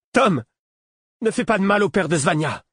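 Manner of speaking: high, loud pleading shout; boy's frantic cry. A narrator's reading. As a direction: cry out to stop the fight. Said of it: "le cri qui rompit ma concentration". (cri suppliant et aigu d'un garçon)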